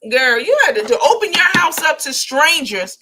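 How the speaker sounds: loud, high exasperated rant; heated rant. Real, not acted. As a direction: scold her, worked up. Ranting at Summer.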